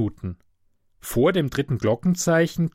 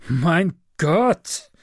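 pained groan of a sick man; moaning; 5 semitones higher, 4 dB louder than usual. A narrator's reading. Sick passenger moaning at the conductor, whining. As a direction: moan it out, tired and aching. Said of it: "stöhnt der hagere Mensch und verzieht weinerlich".

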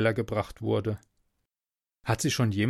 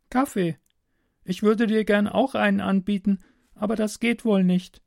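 put-on high, girlish voice; captive girl, matter-of-fact; 8 semitones higher than usual. Girl offers stranger coffee through the wall, high and matter-of-fact. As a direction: speak as an unbothered young girl, pitched high.

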